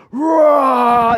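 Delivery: a big battle roar, loud and high; war roar. Acted. Preacher joining the army's war cry, loud roar. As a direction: roar like a soldier at the charge.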